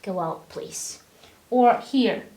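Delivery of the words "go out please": much softer and lower, gentle; polite request. Shown as a demonstration. Teacher demos a polite soft request to a student. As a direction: ask quietly and kindly. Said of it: "you sound polite".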